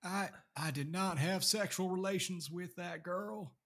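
high, slow, far-fetched presidential impression; Bill Clinton. Shown as a demonstration. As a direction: stretch the words out like a badly imitated politician. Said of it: "try a Bill Clinton right now".

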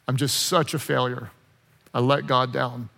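low, quiet self-reproach; dejected. Acted. Preacher voicing Peter after his betrayal, quietly dejected. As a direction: sink down low and soft, in despair.

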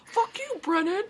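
high mock-angry jab; high silly voice. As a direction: fire off a squeaky insult. Mock insult banter.

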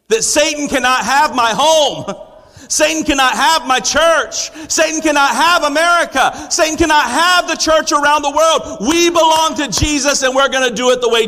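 rising preaching shout. Real, not acted. Pastor rallying the congregation against Satan, in a full-voiced shout. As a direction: proclaim it boldly to the whole room.

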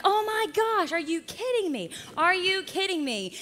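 high-pitched mock outrage; incredulous cartoon anger, not shouted; angry. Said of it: "One of the angry ones like".

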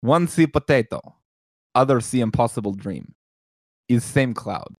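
put-on deadpan the joke in an Eastern European accent. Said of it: "I'll do it with the joke".

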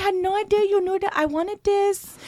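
high, gushing hammed-up accent; mock Chinese accent. Husband thanking his wife in a high, hammed-up mock accent. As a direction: ham up a clumsy Chinese accent. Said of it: "like, Chinese or something".

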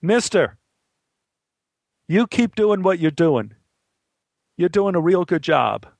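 calling up. Acted. Boy to the actor on stage, encouraging call. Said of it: "called up to the stage".

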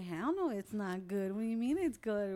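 loud, slow retold protest; indignant sibling shouting down a brother; mock outrage. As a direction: belt it out like you can't believe him. Retelling friends confronting a brother.

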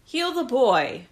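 slow, loud command. A narrator's reading. Captain ordering the drugged healer, commanding. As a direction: order it firmly, each word weighted. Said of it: "Huda commanded".

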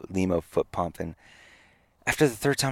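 normal talking voice

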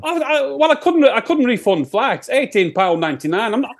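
put-on Sheffield singer, worked up. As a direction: stammer into a defensive Sheffield protest. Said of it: "it's my Sheffield accent".

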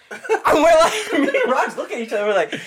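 high, laughing protest; incredulous retelling through laughter; laughing disbelief. Real, not acted. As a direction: tell it while cracking up, voice climbing. Cracking up while retelling disbelief.

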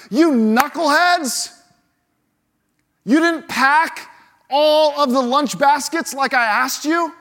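hammed-up scold; mock scolding. Pretend Jesus scolding disciples over lunch, mock angry.

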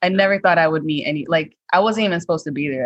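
usual speaking voice

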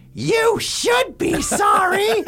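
shrill high screech, slow and loud; loud old lady yell. Retold or imitated. Old lady in the supermarket scolding him, loud high yell. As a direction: yell like an offended old woman.

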